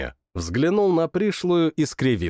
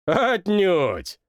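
laughing; 5 semitones higher than usual. Acted. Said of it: "расхохотался он".

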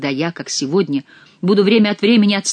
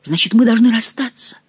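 ordinary delivery, nothing marked

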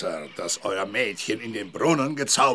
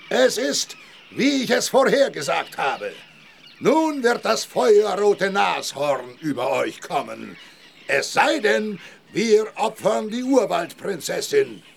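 much higher, loud, building; rabble-rousing; 9 semitones higher, 3 dB louder than usual. Acted. Medicine man stirs up villagers against the princess, loud. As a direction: whip the crowd into a frenzy.

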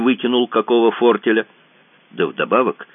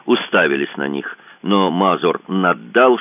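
plain reading voice

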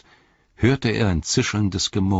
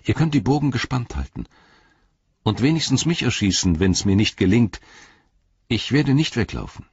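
put-on higher, earnest young hobbit pleading; Frodo's voice; 6 semitones higher than usual. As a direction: speak up for the creature, higher than the narration.

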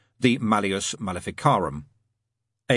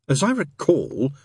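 slow, slightly raised, formal correction; stiff; 6 semitones higher, slower than usual. A narrator's reading. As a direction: correct him a touch stiffly. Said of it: "said the angel stiffly".

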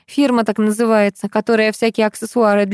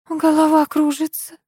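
whispering; 6 semitones higher than usual. Acted. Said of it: "прошептала Николаева".

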